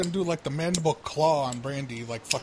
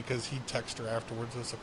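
normal talking voice

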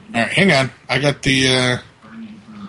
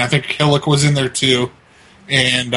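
ordinary delivery

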